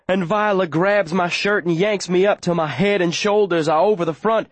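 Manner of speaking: ordinary delivery, nothing marked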